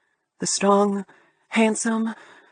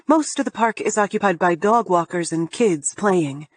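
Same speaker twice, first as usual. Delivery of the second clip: ordinary delivery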